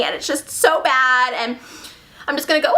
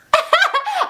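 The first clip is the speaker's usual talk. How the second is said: real high-pitched laugh; amused, squeaky laughter; high giggle. Real, not acted. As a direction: burst into a quick giggle. Laughs at own retelling.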